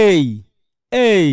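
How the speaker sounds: এই drawn out as a long "ey"; long vowel demo. Shown as a demonstration. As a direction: stretch each এই, gliding from "e" into "i". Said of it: "এটা long উচ্চারণ কি". (এই লম্বা করে টেনে)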